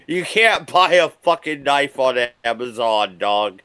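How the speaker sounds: ordinary delivery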